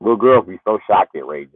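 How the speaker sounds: usual speaking voice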